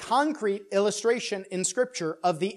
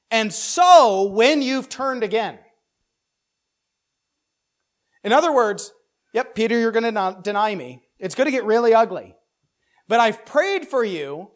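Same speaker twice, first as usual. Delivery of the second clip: louder, higher, earnest; interpretive reading as Jesus; 4 semitones higher, 8 dB louder than usual. Acted. Pastor voicing Jesus reassuring Peter, louder and earnest. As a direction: read it as Jesus assuring Peter. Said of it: "interpretive reading".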